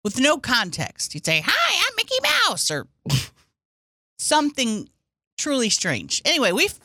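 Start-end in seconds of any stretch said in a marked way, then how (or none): 1.5-2.7 very high falsetto cartoon voice; high mock cartoon greeting; Mickey Mouse. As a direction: squeak it like a cartoon mouse, imitating. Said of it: "doing different voices".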